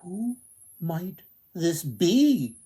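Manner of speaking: high, slow, amazed wonder; wondering aloud. Acted. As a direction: let the question float up in awe. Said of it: "the prince wondered".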